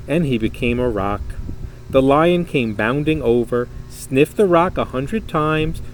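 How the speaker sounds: usual speaking voice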